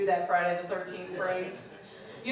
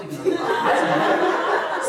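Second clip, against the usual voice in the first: laughing aside; 12 semitones higher, 7 dB louder, slower than usual. Real, not acted. Punchline aside.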